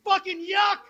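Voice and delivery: high-pitched imitated disgusted yell; mock scream. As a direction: squeal it like a grossed-out stranger. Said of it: "she screams".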